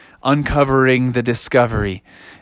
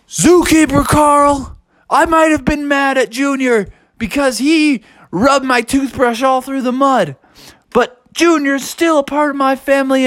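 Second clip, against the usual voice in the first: high, strained outraged bear voice; storybook bear full of rage; furious bear; 10 semitones higher than usual. Acted. Angry bear confronts zookeeper Carl.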